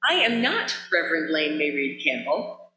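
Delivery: ordinary talk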